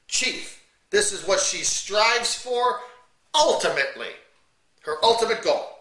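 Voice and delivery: slow, loud, drawn-out pulpit emphasis; preacher stressing each word slowly; forceful preaching. Acted. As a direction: hammer home the chief goal word by word. Preaches the chief goal slowly.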